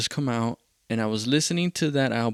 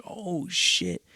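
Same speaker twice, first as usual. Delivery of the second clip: retold dismay; 7 semitones higher than usual. Retold strain on vocal cords.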